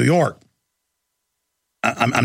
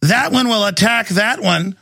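mock bossy woman; 6 semitones higher than usual. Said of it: "She goes".

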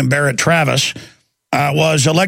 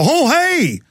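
high, exaggerated imitation of amused cops; mock laughing cops; 10 semitones higher than usual. Cops recognize the host at a detour, mock amused greeting. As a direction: greet him in a high mocking cop voice. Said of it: "the cops both laugh and go".

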